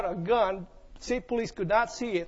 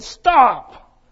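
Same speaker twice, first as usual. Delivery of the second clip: sharp loud cop's shout of stop; retold police shout; shouted command; 11 dB louder than usual. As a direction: bark it like a trooper. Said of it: "And he said".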